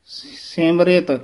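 ਸਿਮਰਿਤ slow, with the sihari on t; careful pronunciation. Shown as a demonstration. Teacher models ਸਿਮਰਿਤ for students. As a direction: say ਸਿਮਰਿਤ slowly, i on both. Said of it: "ਇਹਦਾ ਉਚਾਰਨ ਹੈ".

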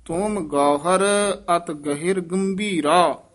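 ਤੁਮ Gauhar with a catch, then slow ਅਤ ਗਹਿਰ ਗੰਭੀਰਾ; ਗਹਿਰ said as gahir, ਗੰਭੀਰਾ with a nasal gam, clearly; careful recitation. Shown as a demonstration. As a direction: recite slowly and pause after ਗੰਭੀਰਾ. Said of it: "ਇੱਥੇ ਵਿਸਰਾਮ".

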